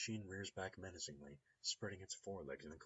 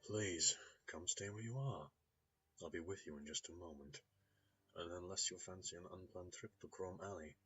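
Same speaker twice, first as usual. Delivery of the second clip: put-on a light Russian accent, smooth and polite. A mechanic warns the visitor in a light Russian accent. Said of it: "There's a hint of a Russian accent there".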